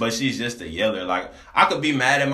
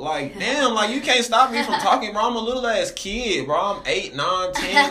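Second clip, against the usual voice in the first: put-on high-pitched, animated pleading of a talkative child; indignant kid's protest; 10 semitones higher than usual. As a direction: plead your case in a high, excited voice. Acts out childhood self protesting talking rules.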